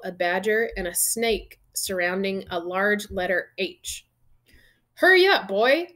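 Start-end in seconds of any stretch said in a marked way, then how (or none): 4.9-5.9 high, loud impatient call; shouting. A narrator's reading. Uncle Vernon calls the boy from the kitchen, shouting. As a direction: call out sharply from another room. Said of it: "shouted Uncle Vernon from the kitchen".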